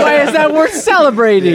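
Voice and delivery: loud, high-pitched challenge; exasperated disbelief. Real, not acted. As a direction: demand it in fed-up disbelief. Host baffled by team's cheering.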